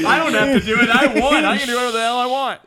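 giddy, laughing victory brag; laughing gloat. Real, not acted. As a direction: crow about winning through laughter. Gloating winner of the bet.

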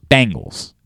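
"Bengals" dito como Bangles, pae-ng-gals; Baltimore pronunciation. Shown as a demonstration. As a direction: say "Bengals" with the a of bang. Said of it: "says Bangles".

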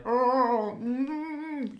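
high far-off mock Wookiee moan; Wookiee moan imitation. Imitating Chewie's moan for a friend. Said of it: "To be like".

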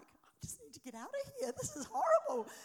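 high, loud panicked wail; mock panic. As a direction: squeal it like you want to flee. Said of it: "And I'm like".

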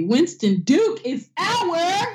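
slow, drawn-out hype announcement; announcer hype. Real, not acted. Hosts crowning Winston Duke bae of the week, drawn-out hype. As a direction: draw it out like an announcer. Hyping the actor.

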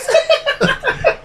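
shrieking laugh; a sudden very high laugh; high squeal of laughter. Real, not acted. Laughs at own joke.

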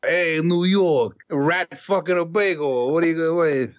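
put-on over-the-top New York loudmouth accent, stretched vowels; mock New York accent. Mock New York local in jest.

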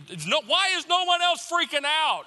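a man sure the plane is hijacked; mock panic at its peak; panicked outburst.

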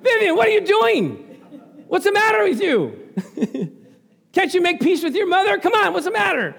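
high, loud teasing rebuke through laughs; laughing mock scold. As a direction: playfully scold a congregant by name. Preacher scolds congregant playfully.

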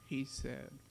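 plain reading voice

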